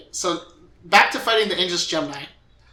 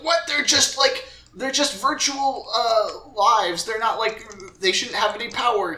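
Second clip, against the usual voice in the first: higher-pitched mock disbelief; incredulous; 6 semitones higher than usual. Retelling Number Zero's objection to a listener, incredulous. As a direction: voice a baffled character protesting, pitched up.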